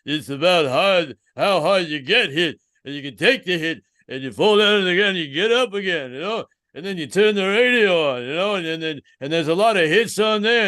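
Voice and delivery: slurred, mumbling Philly boxer voice; Rocky. Acted. Boxer father lecturing his son, hammed-up Philly mumble. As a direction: mumble a lesson like a punchy fighter. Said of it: "He's like".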